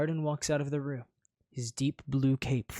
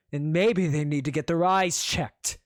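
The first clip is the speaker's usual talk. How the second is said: ordinary delivery, nothing marked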